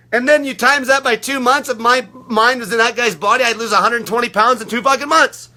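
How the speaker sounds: ordinary talk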